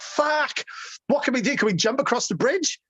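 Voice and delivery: high alarmed outburst; shocked disbelief. Retold or imitated. Told the bridge is gone, a high shocked outburst. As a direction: react like the road just vanished.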